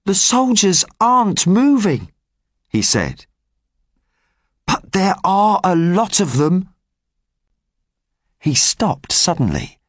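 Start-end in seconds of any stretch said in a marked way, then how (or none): 0.0-6.7 lightly voiced street boy. Gavroche reports to rebels.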